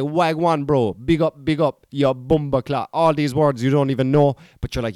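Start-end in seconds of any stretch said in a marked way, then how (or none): 0.0-2.9 put-on Jamaican London patois; Jamaican London slang. Fan imitating the gang's greetings, in Jamaican London slang. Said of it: "this Jamaican London kind of crowd".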